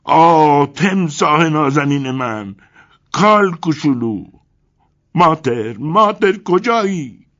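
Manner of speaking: high, loud, slow anguished call; shouting. A narrator's reading. Crocodile owner shouting for his pet Mutter, loud and high. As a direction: cry out for the lost crocodile. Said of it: "سرش را به بالا گرفت و فریاد زد".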